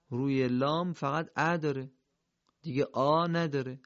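usual speaking voice

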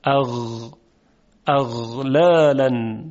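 slow اغلالاً with voiced throaty غ; Arabic ghayn. Shown as a demonstration. Teacher demonstrating a Quranic word for students to copy. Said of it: "شما هم تکرار کنید".

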